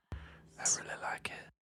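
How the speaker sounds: soft breathy whisper. Real, not acted. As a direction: whisper it as an aside. Whispered aside.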